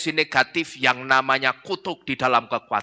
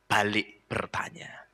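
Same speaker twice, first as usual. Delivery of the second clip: whisper; 6 semitones lower, 10 dB quieter, slower than usual. A narrator's reading. Narator tiba-tiba berbisik.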